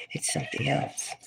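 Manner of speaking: breathy secret-telling whisper; hushed whisper. Real, not acted. Host confiding to viewers, whispering. Ominous reveal about the moon.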